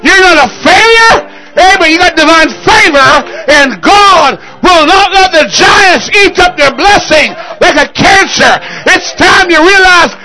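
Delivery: rousing revival preacher in full cry; full-force preaching. Real, not acted. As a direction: preach it hard, punching out each phrase.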